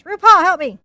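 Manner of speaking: loud playful cry for help. Real, not acted. Painter jokingly begging [name] for help, loud playful cry.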